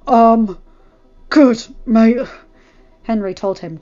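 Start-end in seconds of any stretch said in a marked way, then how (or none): 0.1-2.3 loud, strained, halting reply; through gritted teeth. Acted. Henry getting up hurt, answering through gritted teeth. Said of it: "gritting his teeth as he got up".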